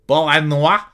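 put-on terrible French accent. Podcaster greets listeners' town, hammed-up terrible French accent. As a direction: put on a bad French accent, rising at the end. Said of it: "That's a terrible accent".

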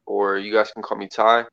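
ordinary talk, nothing marked

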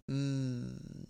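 normal talking voice

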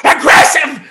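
high, loud, incredulous mock yell; imitating outraged lady. Lady offended at being called aggressive, high outraged mock yell. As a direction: shriek it back as the offended lady. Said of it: "She starts yelling at me".

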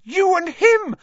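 put-on very high, very loud girl's shriek; shrieked accusation. A narrator's reading. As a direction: shriek it in outrage. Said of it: "Hermione shrieked".

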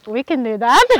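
loud, upbeat retold boast to a husband; eager confidence. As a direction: playfully act out confident enthusiasm, loudly. Retelling eager words to husband.

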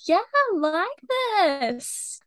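high, very drawn-out imitation; enthusiastic fan. Imitating street interviewees who love the song, enthusiastic. Acts out fans in music video.

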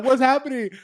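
loud, high-pitched mock panic; freaking out. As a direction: cry out as if panicking. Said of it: "freaking out".

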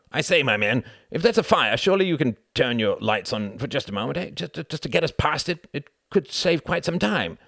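high, insistent appeal with a stammer; fussy entreaty. A narrator's reading. Quentin asking the driver for lights past the fire, entreating. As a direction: talk the driver round, pitched high.

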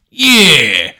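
loud, high mock sidekick yeah; henchman echo. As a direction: crow it like a toadying henchman. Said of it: "little, like, henchman next to them".

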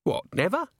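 high-pitched disbelieving question; incredulous. A narrator's reading. Commander doubts golems never harm anyone, incredulous. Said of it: "heard his incredulous voice".

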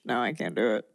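ordinary talk, nothing marked

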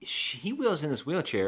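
usual speaking voice

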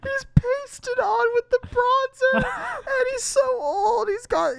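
laughing hard while talking. Real, not acted. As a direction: speak through the giggles. Laughing through lines.

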